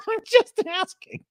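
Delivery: high laughing repeat; mock-innocent laughing. Real, not acted. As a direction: giggle it out innocently.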